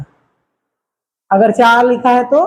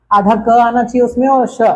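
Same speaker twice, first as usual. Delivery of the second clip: ordinary delivery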